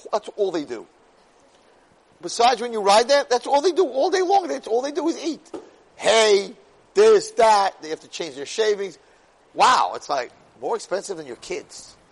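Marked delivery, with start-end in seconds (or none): 0.1-7.8 loud, high-pitched comic rant; exasperated rant. Real, not acted. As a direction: complain louder and higher, piling it up. Rant on what horses cost.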